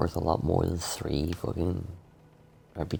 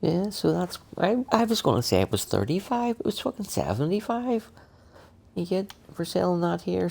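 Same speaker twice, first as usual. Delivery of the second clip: higher, amazed at the price; surprised; 10 semitones higher than usual. Real, not acted. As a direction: pitch jumps high in disbelief. Surprised at shop price difference.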